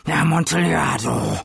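put-on drunken exclamation. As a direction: shout Amontillado like a craving. Fortunato exclaims the wine's name. (Amontillado laut und fallend ausgerufen)

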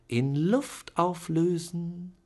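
high, slow honeyed bargaining; sweet coaxing. A narrator's reading. Aunt bargaining with Charlie, sweetly coaxing. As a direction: promise him peace in a sugary tone. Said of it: "sie wedelte mit den langen weißen Fingern".

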